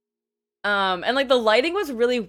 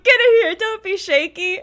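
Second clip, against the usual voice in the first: imitating him, much higher and louder, teasing; loud playful command; 7 semitones higher, 7 dB louder than usual. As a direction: boom it out like a friendly giant pulling you in. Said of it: "He's just like".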